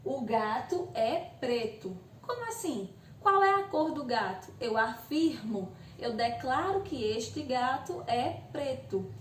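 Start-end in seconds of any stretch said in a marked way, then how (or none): 0.0-1.9 o gato é preto said slow with a falling statement tune; declarative tone. Shown as a demonstration. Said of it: "A outra é uma declarativa". 2.2-4.5 a hint of a confused pupil; lightly voiced student asking; student's question.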